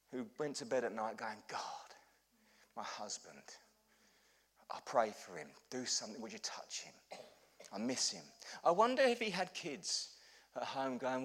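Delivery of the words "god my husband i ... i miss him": soft, low, halting bedtime prayer; quiet pleading prayer. Retold or imitated. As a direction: pray privately for a lost husband, with long pauses. Imagined wife praying at night.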